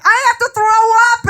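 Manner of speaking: high, queasy groan; about to be sick; lightly voiced drunk teen; drunk boyfriend.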